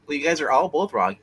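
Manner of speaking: ordinary talk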